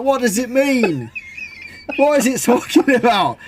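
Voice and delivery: high-pitched incredulous questions; worked-up disbelief; exasperated bafflement. Acted. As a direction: rant in comic confusion at a gadget. Acted exasperation at the babbling speaker.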